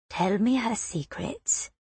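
hushed, soft and rustling; soft boyish voice. A narrator's reading. Winged boy coaxing the girl, soft hush. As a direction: breathe it softly like feathers. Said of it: "soft voice rustles like feathers".

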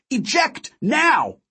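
high pleading command; very high urgent yell; desperate scream. A narrator's reading. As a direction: scream at him to get out. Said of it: "I screamed".

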